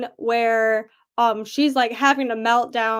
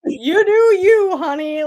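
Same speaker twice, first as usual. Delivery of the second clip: sassy guard; 6 semitones higher, 6 dB louder than usual. Retold or imitated. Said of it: "the guard was like".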